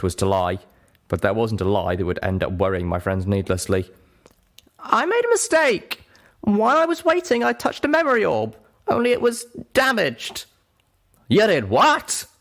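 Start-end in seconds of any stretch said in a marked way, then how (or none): 4.8-10.5 high, small guilty confession; Pip's confession. Acted. Pip confesses her mistake. 11.3-12.3 loud, sharp high snap; snapped. Acted. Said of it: "Calamity snapped".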